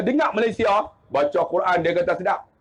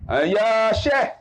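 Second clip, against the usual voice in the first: long, stretched-out call; slow mock call of respect; drawn-out call; much slower than usual. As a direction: draw out the words to hail the sheikh. Calls out to the sheikh.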